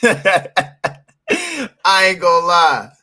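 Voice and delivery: slow words through laughter; drawn out between laughs; laughing. Real, not acted. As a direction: laugh it out as you speak. Laughing at himself.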